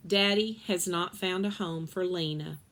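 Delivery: ordinary delivery, nothing marked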